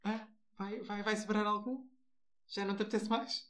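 imitating a greedy partner, teasing; mock eager questions. Mocking her partner's hungry questions, playfully nagging.

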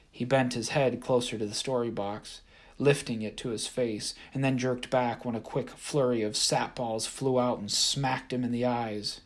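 plain reading voice

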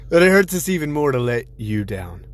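usual speaking voice

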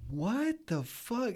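high, drawn-out, incredulous reaction; stunned disbelief. Retold or imitated. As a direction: retell it stretched out in high-pitched disbelief. Retold stunned reaction to alligators.

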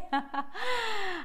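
a squealing high laugh; high giggle. Real, not acted. Host giggles with delight about swimming.

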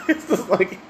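words squeezed out through laughter; laughing. Real, not acted. Retelling the bag of nuts while laughing.

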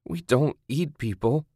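high plaintive whine. A narrator's reading. Captive denying the accusation, whining. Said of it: "she whined".

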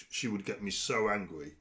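ordinary talk, nothing marked

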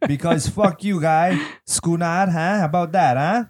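bad comic Italian tough talk; Italian accent. Host improvises an accent bit for his co-hosts, hammed-up Italian accent. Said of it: "I'm totally Italiano".

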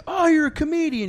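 hammed-up surprised pizza man; mock surprise. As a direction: play the guy's fake delight. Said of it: "He's like".

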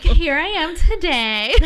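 slow, laughing "here I am today". Real, not acted. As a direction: say it slowly through a laugh. Laughing about how she talks now.

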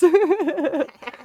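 shrill maniacal cackle; unhinged villain. Real, not acted. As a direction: laugh like a villain losing it. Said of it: "That's my maniacal laugh".

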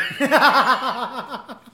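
loud, high burst of laughter; real big laugh. Real, not acted. Laughing at almost fighting over sushi, real hearty laugh. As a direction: laugh out loud, letting it trail.

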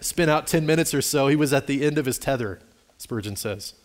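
usual speaking voice

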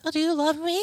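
high, needy little whimper; mock tearful. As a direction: imitate your own weepy pleading. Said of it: "I can't stop crying".

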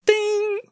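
high ding chime; ding sound. Pretend. Sound effect in story.

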